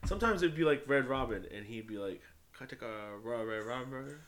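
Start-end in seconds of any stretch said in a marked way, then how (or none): 2.5-4.2 lightly put-on Chinese accent ordering food; a hint of a mocking Chinese accent. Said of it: "he always talked to them in an accent".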